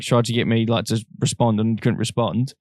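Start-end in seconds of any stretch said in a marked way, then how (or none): none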